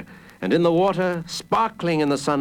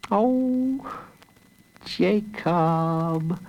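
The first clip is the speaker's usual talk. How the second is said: high, drawn-out tender sigh of a name; high-pitched, lingering fondness; relieved sister; 5 semitones higher, much slower than usual. A narrator's reading. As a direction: coo it slowly in a high voice. Sister kisses the found boy.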